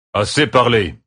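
a mild sharp cry; man's exclamation. A narrator's reading. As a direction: cut them off. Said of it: "s'écria un homme". (s'écrie, assez parlé)

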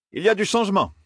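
firm announcement, pitched high. A narrator's reading. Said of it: "d'un ton très ferme". (d'un ton très ferme)

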